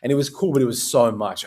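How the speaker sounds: usual speaking voice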